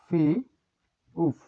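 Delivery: ফি held, a gap, then উফ; syllable by syllable. Shown as a demonstration. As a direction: break ফিউপ into ফি and উফ. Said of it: "এই যে এটির নাম ফিওপ". (ফি আর উফ ভেঙে আলাদা উচ্চারণ)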